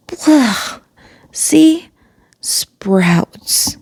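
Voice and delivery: a lightly voiced disgusted boy, high on the first words; mild mock disgust. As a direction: spit out the taste, then a hushed groan. Ron bites a bad bean.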